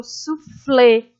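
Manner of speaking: suflê with a drawn-out flê; stressed final syllable. Shown as a demonstration. Teacher showing pupils the strongest syllable, lingering on flê in suflê. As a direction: hold the last syllable of suflê. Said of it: "a sílaba mais forte a gente se demora".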